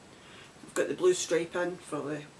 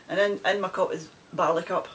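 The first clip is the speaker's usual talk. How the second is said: ordinary delivery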